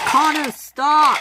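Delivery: high, loud, drawn-out shout; panicked stop call, stretched out; urgent warning. Acted. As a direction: shout to halt a runaway engine. Warning before a crash.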